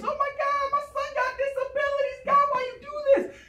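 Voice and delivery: high, wailing plea to God; anguished pastor. Acted. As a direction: cry out to heaven in hammed-up despair. Pastor crying out over son.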